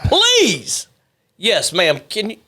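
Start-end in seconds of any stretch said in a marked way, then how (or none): 0.0-0.9 drawn-out plea leaping high on the last please; theatrical, stretched-out begging; mock pleading. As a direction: beg in hammed-up exasperation. Mock despair at co-host's fumbling.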